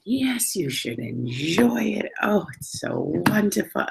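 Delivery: high, drawn-out gushing; carrying on effusively. Retold or imitated. Retelling her chat to a religious man at the olives, gushing. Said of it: "I don't know why I'm carrying on".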